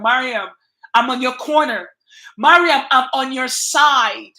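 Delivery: loud mock cheer from a fan; imitated shouting fan. A viewer's loud cheer of support, shouted. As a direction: call out like a loyal fan cheering. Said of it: "You screaming at the top of your voice".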